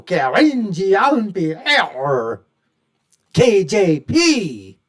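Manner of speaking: loud, drawn-out mock announcement; mocking name-calling. Real, not acted. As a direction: stretch the name out mockingly, very slowly. Mocking the press secretary's name.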